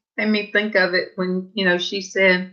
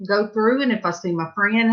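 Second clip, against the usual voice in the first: ordinary talk, nothing marked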